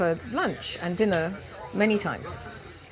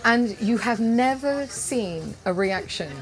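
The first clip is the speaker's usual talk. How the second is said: usual speaking voice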